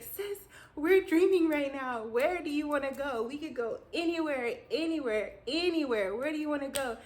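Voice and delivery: high, loud, thrilled invitation; bursting with dream excitement; excited. Retold or imitated.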